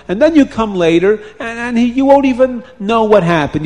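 high, insistent pleading; urgent persuasion. A narrator's reading. Tied-up man persuading the wife to save him, urgent. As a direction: talk her into it, loud and high. Captive urges his plan, begging.

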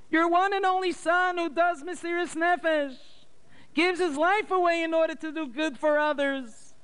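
very high mourning mother's voice; mother cries to her late husband, high and lamenting; bitter lament. A narrator's reading. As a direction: wail over her son's arrest. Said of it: "And she cried bitterly".

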